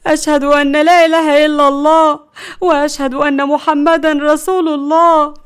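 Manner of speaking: high, loud doomed lament; panicked wailing. Acted. Grandmother expects a crash and recites her last prayer, panicked. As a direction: wail as if these are last words.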